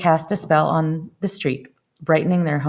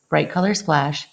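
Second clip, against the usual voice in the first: ordinary delivery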